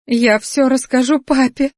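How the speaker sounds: high, tearful tattling; lightly acted sobbing threat; whimpering. A narrator's reading. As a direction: whine it like an upset girl. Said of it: "всхлипывала она".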